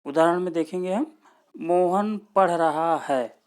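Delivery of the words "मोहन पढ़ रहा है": slow, loud example sentence, full stop after है. Shown as a demonstration. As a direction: spell out मोहन पढ़ रहा है slowly, stop on है. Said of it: "यहाँ पे हम पूर्ण रूप से स्टॉप ले रहे हैं". (मोहन पढ़ रहा है धीरे, है पर पूर्ण विराम)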